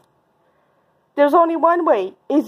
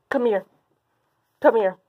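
ordinary talk, nothing marked

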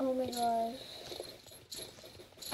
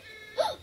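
sharp gasp; 9 semitones higher than usual. Real, not acted. Sees the horse.

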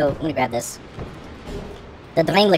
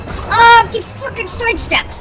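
high-pitched outraged cry; exasperated yell; 14 semitones higher, 4 dB louder, slower than usual. Real, not acted. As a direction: yell it in drawn-out frustration. Enraged at the boss dodging.

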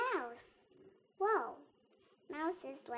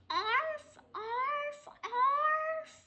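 high little barks; yappy dog; 10 semitones higher, much slower than usual. Acted. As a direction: yap like a small dog. Child reading dog sound.

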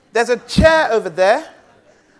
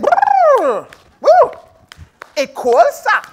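high shivering brrr and woo; old Jamaican lady shivering; 10 semitones higher, 5 dB louder, slower than usual. Acted. Old Jamaican lady freezing at the window, shivering. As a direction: shiver through it like she is freezing. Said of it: "She said".

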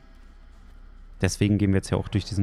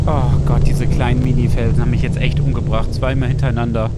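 frustrated shouting; 3 semitones higher, 8 dB louder than usual. Real, not acted. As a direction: shout in real disbelief. Died to tiny rocks twice.

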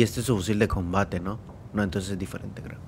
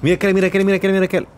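excited; 6 semitones higher, faster than usual. Real, not acted. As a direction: hype it up, spotting someone. Excited gamer spotting enemies. (emocionado, gritando)

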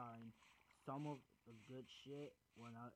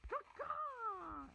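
high squeaky caw caw; a weak, cracked crow call; imitated crow caw. Sick gamer trying a crow call, high squeaky caw. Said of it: "can't even make the sound".

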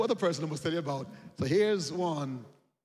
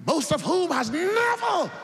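high, loud preacher's cry; ringing sermon shout; preaching shout; 11 semitones higher, 7 dB louder than usual. Real, not acted. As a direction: lift the line to the rafters. Preacher stresses the miracle.

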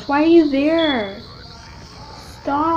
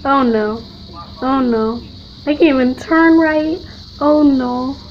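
ordinary delivery

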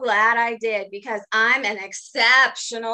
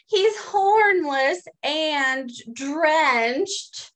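ordinary delivery

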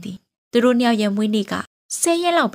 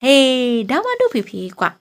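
put-on a touch of a happy child's shout; joyful cry; 4 semitones higher, 5 dB louder than usual. Daughter cheers her father's promise, light happy shout. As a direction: cheer for dad, mildly raised. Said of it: "အဲ့လိုအော်လိုက်ပါတယ်".

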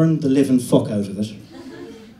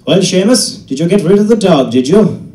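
loud, much higher probing question; dad's loud question; 8 semitones higher, 13 dB louder than usual. Retold or imitated. Dad asking the farmer about the dog, loud and high. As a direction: call it out across the farmhouse.

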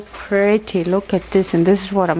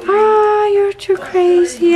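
loud, high, delighted cry; thrilled exclamation; 9 semitones higher, 5 dB louder, slower than usual. Real, not acted. Vlogger to generous friend, thrilled. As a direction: burst out, overwhelmed by the gift.

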